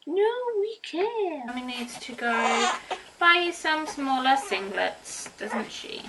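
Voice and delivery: put-on high, sing-song talk to a baby. Mum cooing to her newborn son, high baby talk. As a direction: coo at your little one.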